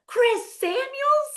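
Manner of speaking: high, drawn-out mock yell; imitated shocked shriek; Jassy yelling. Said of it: "And Jassy yells".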